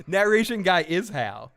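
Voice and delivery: usual speaking voice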